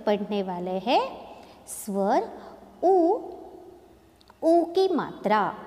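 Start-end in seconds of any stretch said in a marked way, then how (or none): 2.8-5.5 उ sung out high and long; stretched vowel. Shown as a demonstration. Teacher shows students the long उ, stretched out. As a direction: draw out the उ sound for the class. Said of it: "हमारे उच्चारण में ही देखिए".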